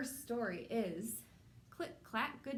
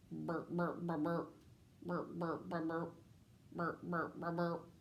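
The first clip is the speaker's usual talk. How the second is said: low croaky frog burps; croaking frogs; 4 semitones lower, slower than usual. Acted. Parent voicing the frogs for a child, low croaking burps. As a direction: croak each one like a pond frog.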